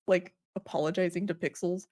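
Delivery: normal talking voice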